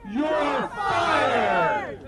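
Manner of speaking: loud, drawn-out mock firing; hurled catchphrase, stretched out for the crowd; Donald Trump's catchphrase. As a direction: shout it as a rally punchline. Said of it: "he used to know how to execute".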